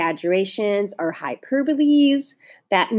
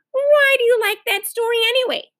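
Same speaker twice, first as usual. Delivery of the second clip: high, raised plaintive demand; higher, fed-up questioning; exasperated question; 12 semitones higher, 4 dB louder than usual. A narrator's reading. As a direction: ask in sisterly exasperation.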